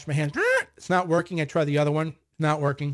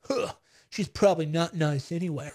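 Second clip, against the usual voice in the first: sour dismissive man; 2 semitones lower than usual. Retold or imitated. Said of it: "a man goes".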